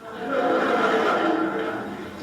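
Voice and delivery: a short airy chuckle at his own joke; breathy laugh. Real, not acted. Speaker laughing at his comeback to the woman, breathy chuckle.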